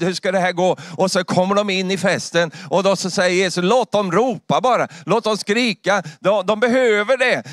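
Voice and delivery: building to a high, forceful climax; impassioned preaching. Real, not acted. Preacher defending the rescued who shout at heaven's feast, impassioned. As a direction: preach with rising urgency, pitched high.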